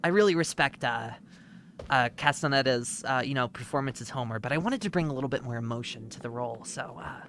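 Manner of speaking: lightly voiced earnest actor; a hint of a self-serious actor; mock actor interview. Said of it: "it's like a behind the scenes. It's, like, me talking".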